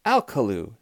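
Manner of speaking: understated scolding shout; mild cross shout. A narrator's reading. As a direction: call the names sternly. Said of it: "shouted Missus Rogers".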